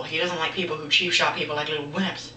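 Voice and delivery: normal talking voice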